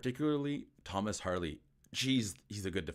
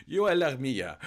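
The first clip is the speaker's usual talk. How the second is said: Yoel Armia, loud and higher in a hammed-up Finnish accent; exaggerated Finnish accent, louder and higher; classic Finnish accent; 6 semitones higher, 8 dB louder, slower than usual. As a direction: belt out the name Yoel Armia like a proud Finnish commentator. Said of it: "classic Finnish accent".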